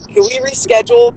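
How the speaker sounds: high, fed-up retold plea; exasperated plea. Patient retells begging nurses to reschedule, exasperated. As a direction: ask it like you're at your limit. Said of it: "And I was like".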